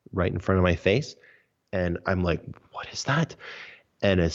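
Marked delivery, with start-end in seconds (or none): 2.7-3.4 breathless whispered question in the dark; awed whisper. Retold or imitated. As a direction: whisper it, stunned by what you see. Startled by energy in dark room.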